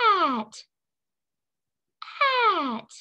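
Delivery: tiny little mouse squeak; little mousy voice; squeaky mouse voice. Shown as a demonstration. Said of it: "tiny little mouse squeaky voice".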